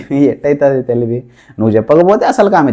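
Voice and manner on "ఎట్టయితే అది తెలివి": laughing. Retold or imitated. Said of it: "ఇది నా tactic, నా తెలివి".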